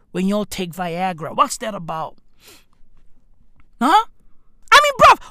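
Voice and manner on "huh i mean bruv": loud, high-pitched exclamation; incredulous outburst. Real, not acted. Comic demanding answers from his bruv, incredulous outburst. Incredulous about blue streaks.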